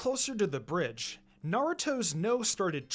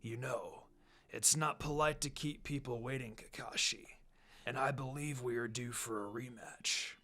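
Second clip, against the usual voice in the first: low, soft, menacing villain taunt; 5 semitones lower, 7 dB quieter than usual. Acted. Zabuza taunting Kakashi before their rematch, low menacing voice. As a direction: purr the threat quietly at your rival. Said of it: "Zabuza taunted".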